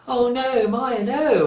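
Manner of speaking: mock dismayed pity. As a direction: imitate someone pitying with dismay. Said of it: "not in an empathising way, but in a".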